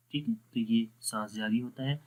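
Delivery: ordinary talk, nothing marked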